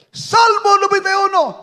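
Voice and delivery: high, loud call; shout. Retold or imitated. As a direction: proclaim it a third time. Third shout of the psalm.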